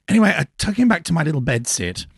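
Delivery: ordinary talk, nothing marked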